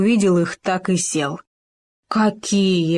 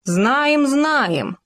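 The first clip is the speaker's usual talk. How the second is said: pompous; 7 semitones higher than usual. Acted. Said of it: "важно сказали Хома и суслик".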